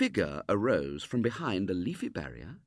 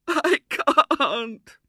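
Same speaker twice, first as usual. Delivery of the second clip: high, loud tearful wail; wailing little girl; 11 dB louder than usual. A narrator's reading. Girl refusing William's offer, despairing wail. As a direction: break into a wail mid-word. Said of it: "wailed the little girl".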